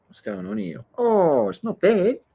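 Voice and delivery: playful high voice. Pretend. Surfer sneaking a look at the waves, playful high voice. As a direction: squeak it out playfully.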